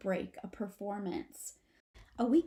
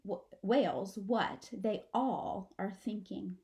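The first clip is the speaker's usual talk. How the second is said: ordinary delivery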